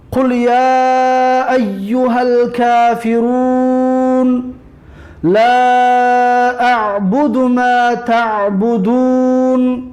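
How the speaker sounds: slow, drawn-out chanted recitation; measured tajwid chant; Quran recitation. Acted. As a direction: intone each verse slowly and evenly.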